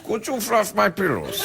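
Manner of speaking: a lightly put-on German accent; mock German accent. Host imitating himself asking nude Germans in mock German accent. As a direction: ask in a jokey fake German accent. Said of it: "In German".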